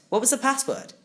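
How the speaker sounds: plain reading voice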